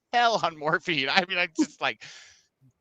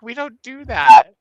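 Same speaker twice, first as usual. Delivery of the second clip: loud, slow, higher flat no; firm doctor refusal; 6 semitones higher, 13 dB louder, slower than usual. Retold or imitated. Doctor bluntly refusing to knock her out, loud and slow. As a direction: shut the idea down firmly.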